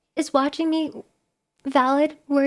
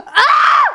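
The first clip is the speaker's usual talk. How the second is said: gasp, then high loud scream; exasperated scream; 14 semitones higher, 10 dB louder than usual. Real, not acted. Streamer denying chat spent savings on the coin, exasperated scream. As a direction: break off into a sudden high shriek. Exasperated outburst mid-denial.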